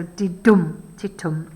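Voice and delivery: "didum, titum" with the second beat punched; stress on second syllable. Shown as a demonstration. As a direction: hit the back half of "didum" and "titum". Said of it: "the stress is on the second syllable".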